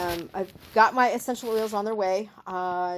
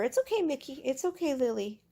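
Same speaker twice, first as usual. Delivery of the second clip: soothing pets; 6 semitones higher than usual. Real, not acted. Soothing nervous cats.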